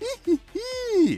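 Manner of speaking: high maniacal cackle; mad scientist laugh. Acted. Sly joke about the bull.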